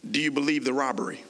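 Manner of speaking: rushed question; fast. Shown as a demonstration. Preacher rattles off the robbery question quickly. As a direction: race through the robbery question. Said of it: "see how fast that was?".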